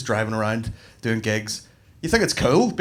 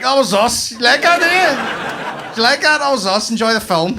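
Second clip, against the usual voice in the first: smug local pride; 7 semitones higher than usual. Retold or imitated. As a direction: rub it in with a proud grin. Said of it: "And we're like".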